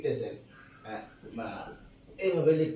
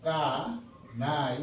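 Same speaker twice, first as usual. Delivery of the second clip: কা, pause, নাই; syllable by syllable; much slower than usual. Shown as a demonstration. Teacher sounding out a long word just written, কা and নাই said apart. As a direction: split it into কা and নাই. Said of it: "একলাই আসতেছে".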